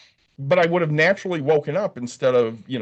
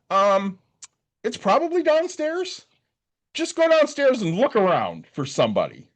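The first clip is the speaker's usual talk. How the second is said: high, drawn-out hesitant receptionist; unsure receptionist; 7 semitones higher than usual. Retold or imitated. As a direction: guess aloud in a higher front-desk voice.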